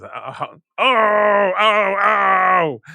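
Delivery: high pained cries of oh, oh; yelling in pain. A narrator's reading. Santa crying out in pain from the electrified bola. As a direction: cry out loud and high as the bola shocks him. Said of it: "he yells in pain".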